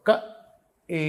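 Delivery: "క", pause, "ఏ", each separate; correct mantra syllables. Shown as a demonstration. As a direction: keep "ఏ" a pure vowel with no y glide. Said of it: "ఇక్కడి నుంచి పలకాలి".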